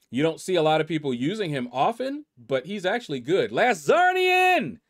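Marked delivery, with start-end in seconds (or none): 3.5-4.8 loud, much higher, stretched cheer; excited call-out. Real, not acted. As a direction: belt out the name like an announcer. Cheering a top player name.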